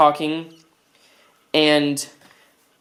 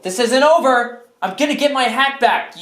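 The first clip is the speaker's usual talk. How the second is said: high, loud vow; defiant outburst; 6 semitones higher, 3 dB louder than usual. Acted. Vowing to recover the hat, angry defiance. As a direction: protest loudly, pitch raised.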